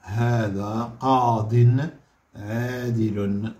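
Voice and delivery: هذا قاض عادل said slowly; slow, word-by-word sentence; slow dictation. Shown as a demonstration. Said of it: "اش غتولي؟".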